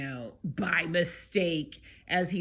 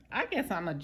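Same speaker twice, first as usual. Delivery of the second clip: ordinary delivery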